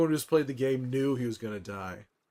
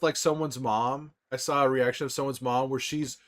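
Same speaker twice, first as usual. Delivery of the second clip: normal talking voice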